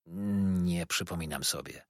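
slow, soft, low answer; shy reply. A narrator's reading. Boy answering the waiter, shy and slow. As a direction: answer hesitantly, drawing it out.

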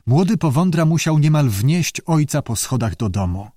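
ordinary delivery

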